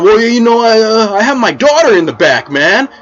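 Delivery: high, loud indignant whine; angry dad. Retold or imitated. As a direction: protest shrilly about his daughter. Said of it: "He's like".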